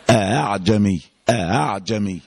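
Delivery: أعجمي with a full first hamza and the second one softened between hamza and a; eased second hamza. Shown as a demonstration. Teacher to students, demonstrating أعجمي with a softened second hamza. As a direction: say أعجمي with the second hamza only half closed. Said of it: "عمل إيه؟ قال الهمزة الأولى أ".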